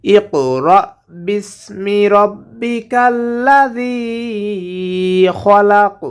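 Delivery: slow, drawn-out tilawat; Quran recitation. Shown as a demonstration. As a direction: chant it slowly for students to copy. Teacher recites the ayah as model. (শিক্ষকের ধীর তিলাওয়াত)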